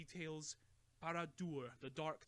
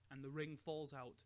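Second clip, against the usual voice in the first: ordinary delivery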